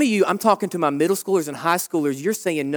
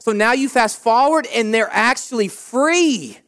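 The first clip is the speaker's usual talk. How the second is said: ordinary talk, nothing marked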